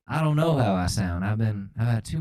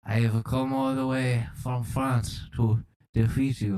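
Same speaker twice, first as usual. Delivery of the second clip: hammy French racing-driver challenge; theatrical mock-French delivery; fake French accent. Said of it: "That was a fake accent".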